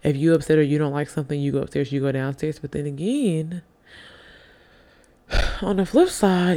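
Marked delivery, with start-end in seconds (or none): none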